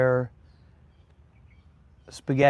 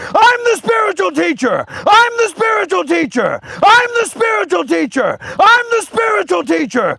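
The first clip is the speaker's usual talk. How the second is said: high, loud screamed chant; screaming; 13 semitones higher, 5 dB louder than usual. Acted. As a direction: scream it over and over. Chanted claim turning into screaming.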